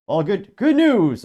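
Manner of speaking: high, loud cartoon professor catchphrase; hammed-up impression, pitch leaping on the repeat; badly faked Professor Farnsworth. Podcaster reading a Futurama meme as the professor, badly faked impression. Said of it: "I can't do his accent".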